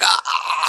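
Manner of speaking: high squeaky mock yelp of strain; a comic cry of an overloaded character. Gamer mimicking an overloaded character with a squeaky yelp.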